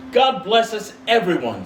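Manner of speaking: put-on high little child's voice; a sickly child's happy toast; Tiny Tim. A narrator's reading. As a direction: pipe it up like a small boy.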